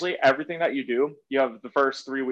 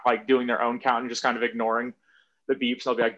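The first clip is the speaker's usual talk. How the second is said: usual speaking voice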